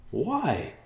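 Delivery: high-pitched, baffled question; bewildered. A narrator's reading. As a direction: ask it high, not shouted. Thomas asks, bewildered by warning.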